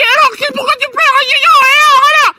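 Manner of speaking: a mother screaming in a wild lament; hammed-up shrieking mother voice. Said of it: "tu me fais mal aux oreilles".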